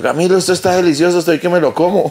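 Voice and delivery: high, bright overselling praise; mock cheerful lie; fake enthusiasm. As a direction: bluff like everything is fine. His fake enthusiasm to Camilo.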